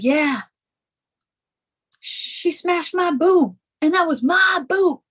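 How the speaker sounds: mock drunk rapper wail, high and drawn out. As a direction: wail it like an aggrieved wannabe rapper. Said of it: "she would turn into [name]".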